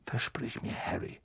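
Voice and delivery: very soft urgent murmur; hushed, hasty plea. A narrator's reading. Mister Weasley begs Harry for a promise, hushed urgency. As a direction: plead under your voice. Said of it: "noch hastiger".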